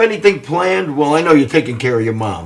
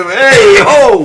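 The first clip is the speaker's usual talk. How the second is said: friend's New York greeting; 9 semitones higher, 8 dB louder than usual. Retold or imitated. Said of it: "sounded like I was talking".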